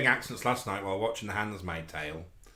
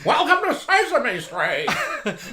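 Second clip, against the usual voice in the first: much higher, louder, slow squeaky puppet voice; slow, hammed-up Muppet greeting; 13 semitones higher, 10 dB louder, much slower than usual. Mocks the host's Aunt Lydia as a Muppet, higher and louder. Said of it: "It's like the Muppets do, Aunt Lydia".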